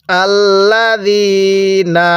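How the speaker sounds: slow drawn-out tajweed recitation; Quranic recitation. Shown as a demonstration. Teacher leading students to repeat, slow recitation. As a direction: stretch each syllable for the class to repeat. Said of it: "এখান থেকে আমার সাথে বলুন".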